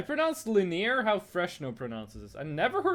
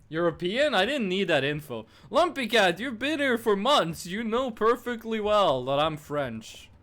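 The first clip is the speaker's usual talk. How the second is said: normal talking voice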